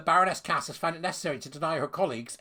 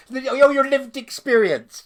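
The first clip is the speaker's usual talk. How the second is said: slow, loud, high sarcastic mock; mocking sneer; 9 semitones higher, 10 dB louder, slower than usual. Mocking the letter writers in a loud sneer. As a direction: sneer the phrase back at them.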